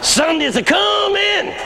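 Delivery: very high, slow, stretched shout; fervent preaching. Retold or imitated. Old pastor answering Friday's despair, triumphant preaching shout. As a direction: ring out the promise like a preacher. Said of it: "beginning to pick up now".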